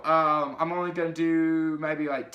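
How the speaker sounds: usual speaking voice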